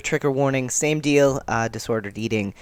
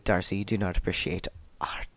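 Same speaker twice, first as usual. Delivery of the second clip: whisper; 5 semitones lower, 7 dB quieter than usual. Retold or imitated. Annoyed friend scolding Darcy.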